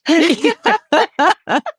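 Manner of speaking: sudden high loud laugh; high burst of laughter. Real, not acted. Laughing about partner's posh accent.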